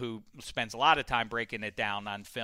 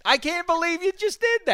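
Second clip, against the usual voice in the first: exasperated; 4 dB louder than usual. Real, not acted. Host reacting to what his co-host just did, exasperated. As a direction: protest it in a high, incredulous wail.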